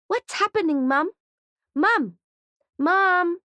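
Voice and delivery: put-on a frightened little boy calling for his mum; high, rising child's cry for mum; scared child. A narrator's reading. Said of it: "says Mally".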